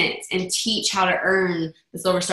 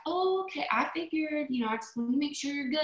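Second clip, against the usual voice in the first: softer, higher and gentle; soft reassurance; 5 semitones higher, 10 dB quieter than usual. Retold or imitated. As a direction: back off gently and kindly. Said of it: "she was like".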